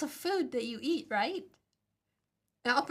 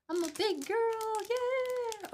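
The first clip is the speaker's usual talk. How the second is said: put-on a much higher, hyped-up cheer of big girl; squeaky cheer; 9 semitones higher, much slower than usual. Shown as a demonstration. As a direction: cheer yourself on like a proud kid.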